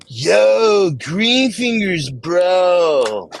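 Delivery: high, loud stoked surfer dude. Shown as a demonstration. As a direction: shout it like a beach bro. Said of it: "How about if I say it like a surfer?".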